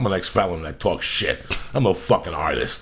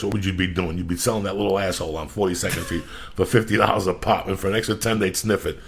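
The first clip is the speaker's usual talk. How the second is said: normal talking voice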